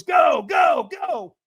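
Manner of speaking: high, loud shouts; mock shouting coach; urgent go command. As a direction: send him off urgently. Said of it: "And he goes".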